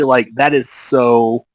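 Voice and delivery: normal talking voice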